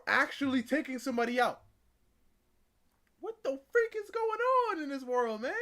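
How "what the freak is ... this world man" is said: higher and louder, disbelieving; exasperated outcry. Real, not acted. Host reacting to the sentences, exasperated outcry. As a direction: throw your hands up in disbelief.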